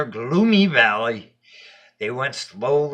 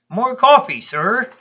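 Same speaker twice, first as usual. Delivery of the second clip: put-on polite child asking; 10 semitones higher, 5 dB louder than usual. Child offers Death more coffee.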